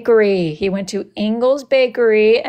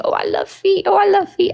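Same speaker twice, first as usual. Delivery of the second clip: very high, breathy falsetto mocking; mock silly voice; 11 semitones higher than usual. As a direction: squeak it out as a goofy parody. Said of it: "if I was in here saying".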